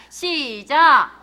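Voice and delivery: loud, slow drawn-out call; loud stretched start cue. Real, not acted. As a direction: pull the room into reading. Cue for reading aloud.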